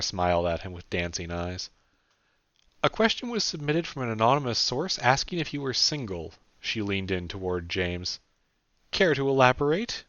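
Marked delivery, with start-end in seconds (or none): 2.8-6.3 put-on higher young woman's voice; Sarah's voice. A narrator's reading. 8.9-10.0 high, sly teasing question. A narrator's reading. Sarah teasing, higher.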